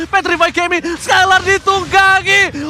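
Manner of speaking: slow, loud drawn-out hype yell; fighting game announcer. Acted. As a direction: stretch the call out at full volume. Caster climaxes on a play.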